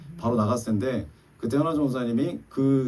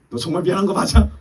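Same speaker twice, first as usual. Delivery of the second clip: loud, higher challenge, half-playful; sharp demand; 8 semitones higher, 9 dB louder than usual. Retold or imitated. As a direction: push back loudly, testing her. Husband presses, checking apology.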